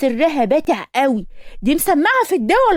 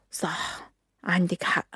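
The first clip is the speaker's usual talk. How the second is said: whispered agreement; 5 semitones lower, 10 dB quieter, much slower than usual. Acted.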